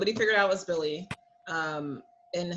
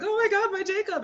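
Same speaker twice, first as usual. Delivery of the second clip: mock distraught fan; 13 semitones higher than usual. Mocking the housemates freaking out over Jacob, high wail. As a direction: overplay the dramatic dismay. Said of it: "You see Marcelo being like".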